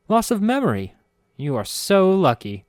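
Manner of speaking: bright high perky voice; perky chime. A narrator's reading. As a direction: chime it out, delighted. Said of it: "she chimed perkily".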